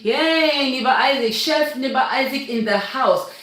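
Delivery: usual speaking voice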